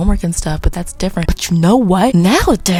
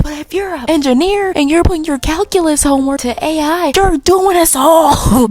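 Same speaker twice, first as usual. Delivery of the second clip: exasperated rant; 8 semitones higher than usual. Real, not acted. Rant at engineers cheating, building up.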